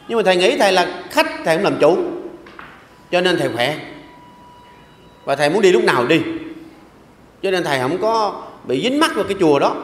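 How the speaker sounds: ordinary talk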